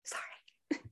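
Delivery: barely-voiced whisper, close to tears; whispered sorry. Real, not acted. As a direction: breathe the apology out almost silently. Apologizing after emotional speech.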